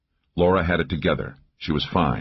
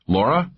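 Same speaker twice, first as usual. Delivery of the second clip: "Laura" rising high at the end; "Laura" with a questioning upward glide; worried question; 7 semitones higher than usual. A narrator's reading. Worried check on unmoving companion.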